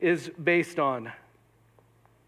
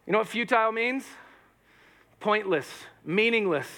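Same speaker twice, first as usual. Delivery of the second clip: usual speaking voice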